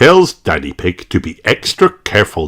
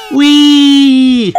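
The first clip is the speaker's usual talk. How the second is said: long high excited call; sliding downhill with joy; gleeful whee; 7 dB louder than usual. A narrator's reading. Children riding Daddy Pig's tummy, gleeful call. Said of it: "they call".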